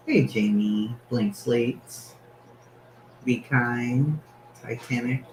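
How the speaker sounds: usual speaking voice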